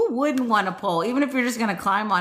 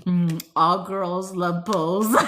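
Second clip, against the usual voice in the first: ordinary talk, nothing marked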